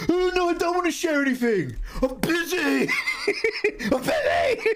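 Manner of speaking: very high squeaky protest breaking into laughter; hammed-up flustered refusal. Streamer acting out refusing to share her art, very high squeak. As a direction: squeak it out in mock panic. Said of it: "acted refusal to share".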